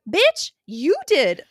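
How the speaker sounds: high, loud, slow comeback; sassy retort. Retold or imitated. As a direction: snap back at him with mock outrage. Retorting to the teacher's line.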